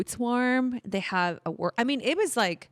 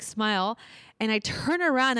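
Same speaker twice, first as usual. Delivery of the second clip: normal talking voice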